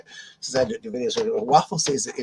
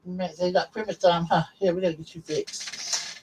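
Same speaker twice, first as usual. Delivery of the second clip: pet talk; 6 semitones higher than usual. Real, not acted. Keeps talking to the pet.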